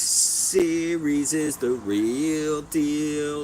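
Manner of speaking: slow, drawn-out announcer build-up; sports announcer hype. Acted. Fan to stream, announcing the series in slow announcer hype. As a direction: boom it like a big-game intro.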